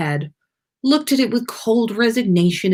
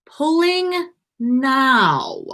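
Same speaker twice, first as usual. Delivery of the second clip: drawn-out warning; 7 semitones higher, much slower than usual. A narrator's reading. Harry warning before pulling.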